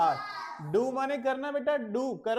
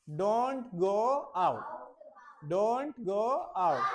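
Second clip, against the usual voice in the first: slow drill; much slower than usual. Shown as a demonstration.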